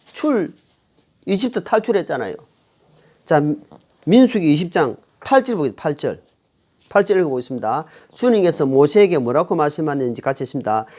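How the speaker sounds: normal talking voice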